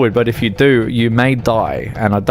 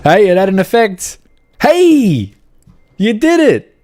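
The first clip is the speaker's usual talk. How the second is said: excited cheering; 9 semitones higher, 4 dB louder than usual. Real, not acted. Friend's truck finally moves.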